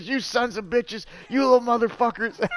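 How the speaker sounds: mock an irate grandpa yelling; cussing grandpa. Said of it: "he's laying into us".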